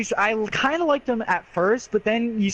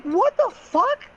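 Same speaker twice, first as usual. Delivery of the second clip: high, slow, stretched-out disbelief; incredulous what the fuck; slower than usual. Retold or imitated. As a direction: squeal it in shocked disbelief. Outraged reaction to husband's flirting.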